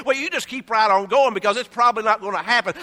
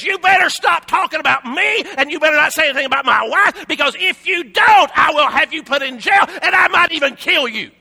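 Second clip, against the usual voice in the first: shouted, louder threat from the king; angry king threatening; 4 semitones higher, 6 dB louder than usual. Retold or imitated. Herod threatening his kingdom, an angry shouted warning. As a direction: bark the threat like a furious king. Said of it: "he stands up to his kingdom and says".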